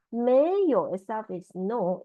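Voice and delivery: ordinary talk, nothing marked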